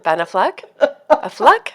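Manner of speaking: questioning "Affleck", said uh-FLUCK; French-sounding Affleck. Real, not acted. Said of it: "It's like you became French".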